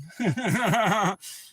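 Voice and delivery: high rolling laugh; hearty burst of laughter; real laughing fit. Real, not acted. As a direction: crack up at your own joke. Cracks up at Sprout joke.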